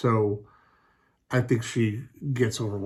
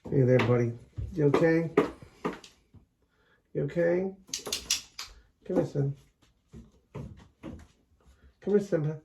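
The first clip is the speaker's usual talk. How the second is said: pet talk; 7 semitones higher than usual. Real, not acted. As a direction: coo at the puppies in a high voice. Calling the dog over.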